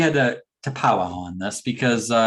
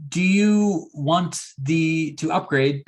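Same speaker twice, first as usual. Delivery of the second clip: mock French desk clerk, drawn out slow; lightly French maître d' voice; French accent; 4 semitones higher, slower than usual. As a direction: lay on a snooty French accent. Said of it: "I'm saying it like in a French accent even".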